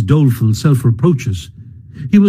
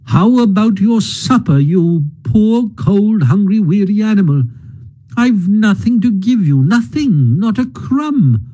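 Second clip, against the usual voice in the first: higher, despairing moan; moaning lament; 7 semitones higher than usual. A narrator's reading. Mole moans he has no supper for Rat, higher. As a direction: grieve over having nothing. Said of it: "he moaned".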